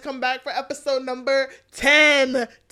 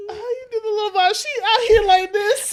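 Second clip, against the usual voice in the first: laughing mockery; 10 semitones higher, 6 dB louder than usual. Real, not acted. Mocking friend, laughing.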